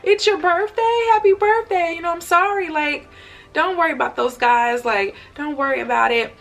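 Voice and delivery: high, gentle voice of a kind officer; kind reassurance. Retold or imitated. Kind officer comforting her.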